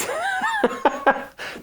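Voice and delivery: squealing high laugh breaking out; real laughing fit; high burst of laughter. Real, not acted. Family cracks up at leaf blug.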